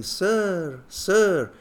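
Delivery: calling out high from across the carriage; distant shout. Retold or imitated.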